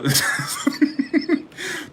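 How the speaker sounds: a high, airy burst of laughter; high breathy laugh. Real, not acted. Cracking up at her own fire sign joke, high airy laugh.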